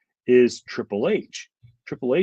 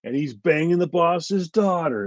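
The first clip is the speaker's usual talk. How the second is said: lightly mocking, higher-pitched promo delivery; imitating wrestler's promo voice; 7 semitones higher than usual. Wrestling fan mimicking a champion's long promos, lightly put-on voice. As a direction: raise the pitch and draw it out like a promo. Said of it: "he would talk like this".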